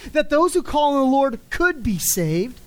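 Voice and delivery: ordinary delivery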